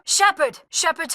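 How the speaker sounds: loud, high shout of the name; urgent cry for help; alarmed shout. Acted. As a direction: call out to a fallen friend. Calling to fallen commander.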